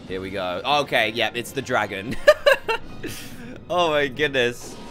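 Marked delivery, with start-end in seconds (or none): 2.2-3.6 silent, high breathless wheeze of a laugh; real breathy laugh burst; high wheezing laugh. Real, not acted. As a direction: crack up without voice. Dragon boss appears.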